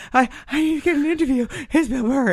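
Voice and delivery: high, fast, breathless plea to get past; frantic fan plea. Retold or imitated. Begging the manager for access.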